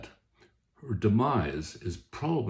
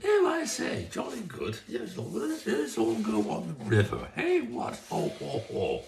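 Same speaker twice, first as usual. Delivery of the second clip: much higher, hammed-up posh upper class English accent; 9 semitones higher than usual. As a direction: play a jolly Edwardian English toff, voice pitched way up. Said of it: "read this in an upper class English accent".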